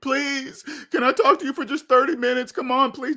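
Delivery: high, imitated begging; wheedling imitation of a desperate ex; mock pleading.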